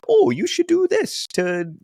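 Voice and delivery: slow, high-pitched mockery of unsolicited advice; mocking impression. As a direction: imitate a meddler in a high put-on voice. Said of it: "That was a nice impression".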